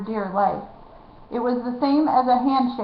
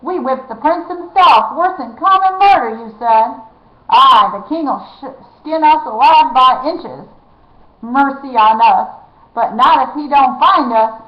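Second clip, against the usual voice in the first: panicked shouting; 3 semitones higher, 8 dB louder than usual. A narrator's reading. Frightened outlaw panicking.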